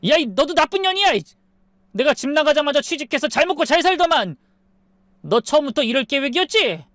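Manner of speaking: high-pitched furious husband; angry yelling. A narrator's reading. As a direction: yell accusations after someone walking away. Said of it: "소리치더군요".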